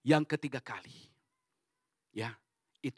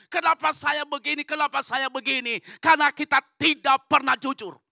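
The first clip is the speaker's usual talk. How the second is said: high, shouted question and answer; forceful preaching; 7 semitones higher, 12 dB louder than usual. Real, not acted. Preacher on why lives go wrong, forceful preaching. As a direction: cry it out and hammer the answer.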